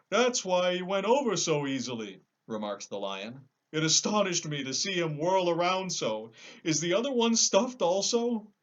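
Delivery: a big cowardly lion protesting; lion, high and loud. Acted.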